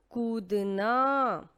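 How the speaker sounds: very slow, stretched teaching read; slow blended word; drawn-out word. Shown as a demonstration. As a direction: stretch each syllable for the class. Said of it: "और फिर है द".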